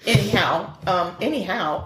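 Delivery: ordinary talk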